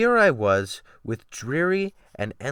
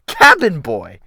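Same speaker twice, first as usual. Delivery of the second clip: loud, higher shouted command; shouted order; 5 semitones higher, 12 dB louder than usual. Acted. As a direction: call it out across the cabin as an order. A sailor calling out an order.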